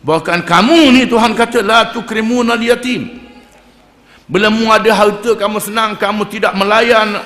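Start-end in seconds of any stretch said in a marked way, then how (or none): 1.6-3.1 measured melodic recitation; Quran recitation. Acted. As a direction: intone the verse like tilawah. Quran verse recited in sermon.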